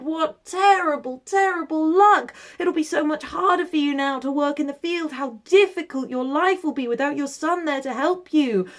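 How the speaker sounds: high, loud fussing villagers; sympathetic neighbours. Acted. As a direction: wail over the bad luck. Neighbours pity the old man.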